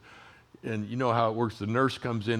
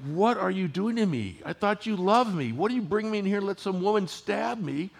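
put-on scared child; 8 semitones higher than usual. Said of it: "he just looked at us like".

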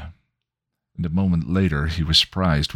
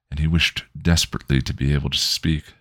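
usual speaking voice

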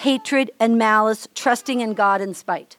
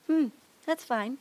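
much softer, acted silent resentment; quiet stewing; 9 dB quieter than usual. Preacher playing someone who stews, quietly resentful. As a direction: stew under your breath, pretending it's fine. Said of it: "you know, you stew it".

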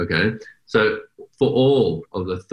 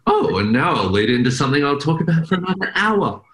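lightly raised, chirpy self-parody; self-mocking rambling host; 6 semitones higher than usual. Host mocking herself to her guest, lightly raised chirpy tone. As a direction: poke fun at her own habit of rambling on. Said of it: "I always do this at the end where I go".

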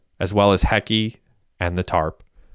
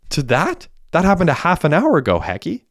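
pitched way up in disbelief; incredulous protest; 9 semitones higher than usual. Acted. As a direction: throw his words back at him, high and indignant. Derek protests back at Hecky.

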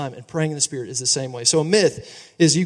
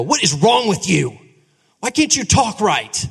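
a hammed-up scolding dad, pulled out slower; mock harsh father; 4 semitones higher, 3 dB louder than usual. Pastor pretending to scold his little son, mock harsh. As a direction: pretend to snap at the child like a stern father.

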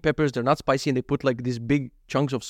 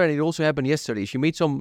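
ordinary talk, nothing marked

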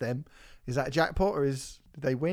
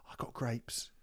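usual speaking voice

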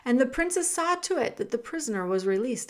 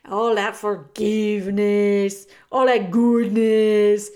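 disgusted character voice; 10 dB louder, slower than usual. Acted.

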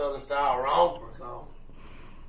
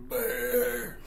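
croaked "Bird" like a frog; talking frog; 6 semitones higher, 6 dB quieter than usual. Acted. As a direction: give the frog a one-word croak. Frog talks back to him.

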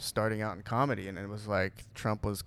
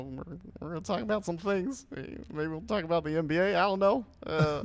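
higher, put-on presidential voice; Barack Obama; 8 semitones higher, 3 dB louder than usual. Guest previewing podcast topics as the president, pitched-up impression. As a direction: lift the pitch into a politician's cadence. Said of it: "you do a good Obama".

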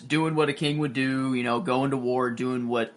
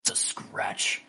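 whispered brush-off; 8 dB quieter, slower than usual. Retold or imitated. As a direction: whisper "It's a scratch" coolly. Drogo's dismissive first line.

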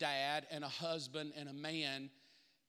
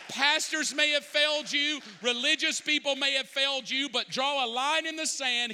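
louder, higher fervent sermon climax; full-force preaching; 6 semitones higher, 5 dB louder than usual. Acted. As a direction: preach it hard to the congregation.

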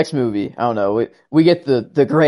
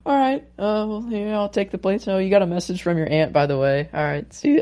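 put-on girlish shy voice; 6 semitones higher, 3 dB quieter than usual. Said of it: "she's like".

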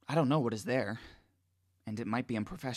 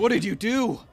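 loud, high, accusing yell; 11 semitones higher, 12 dB louder than usual. A narrator's reading. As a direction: yell it at him in shock. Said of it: "Jawhens yells at me".

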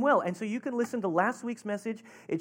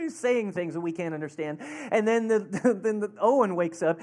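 normal talking voice

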